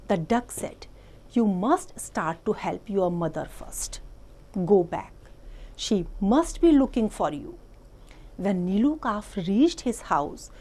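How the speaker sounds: slow, deliberate read-aloud voice; story reading. A narrator's reading. Teacher reading an English story to the class, slow and clear. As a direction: read it out slowly for small children.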